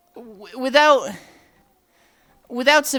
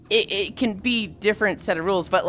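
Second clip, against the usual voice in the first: ordinary talk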